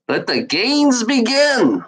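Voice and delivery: slow, high mock proclamation; hammed-up announcer. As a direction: announce the games like a mock ringmaster. Mock announcer to chat trolls.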